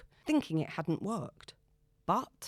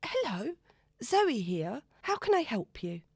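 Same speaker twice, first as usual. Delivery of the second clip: composed; 6 semitones higher than usual. A narrator's reading. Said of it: "attempting to compose herself".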